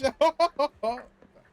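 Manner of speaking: loud high cackle; cackling laugh. Real, not acted. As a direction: burst into a wild cackle. Laughing after insult.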